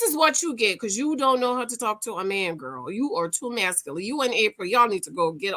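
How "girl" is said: ordinary delivery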